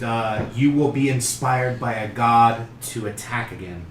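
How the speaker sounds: ordinary delivery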